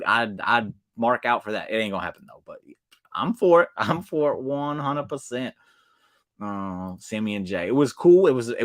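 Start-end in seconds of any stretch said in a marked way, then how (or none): 3.1-5.5 excited. Real, not acted. Backing a dream match.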